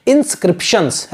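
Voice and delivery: "inscriptions" drawn out very slowly, each syllable clear; teacher drilling one word; careful pronunciation. Shown as a demonstration. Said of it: "ऐसे इसको pronounce करना".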